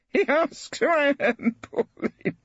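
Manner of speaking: put-on high, slow, amused old buffer; laughing incredulously. A narrator's reading. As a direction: laugh off the question. Brittling amused by the question.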